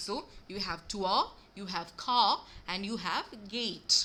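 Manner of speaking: slow model words tour, car and gate; careful native pronunciation. Shown as a demonstration. Teacher repeating the model words for students. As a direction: say each word slowly and clearly. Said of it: "I am giving you these examples".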